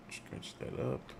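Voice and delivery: whispered. Real, not acted. Whispered repeat.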